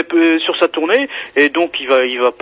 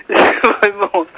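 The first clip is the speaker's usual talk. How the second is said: laughing; 3 semitones higher than usual. Real, not acted. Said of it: "Qu'est-ce qui vous fait rire?".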